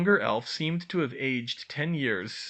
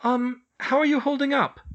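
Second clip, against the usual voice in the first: Hermione voice; 13 semitones higher, 6 dB louder than usual. A narrator's reading. Hermione concerned.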